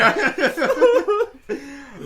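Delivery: high, loud burst of laughter. Real, not acted. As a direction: laugh hard. Laughter after joke.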